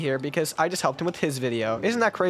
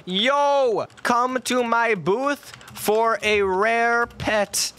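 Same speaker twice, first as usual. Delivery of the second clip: drawn-out high shopkeeper hawk; slow bait call; 5 semitones higher, much slower than usual. Pretend. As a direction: lure buyers, stretch it slowly. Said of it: "I'll be like".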